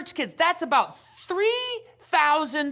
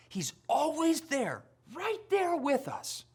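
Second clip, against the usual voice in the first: soft reassuring whisper; 8 dB quieter than usual. Acted. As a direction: whisper it like a secret comfort. Comforting promise to kids.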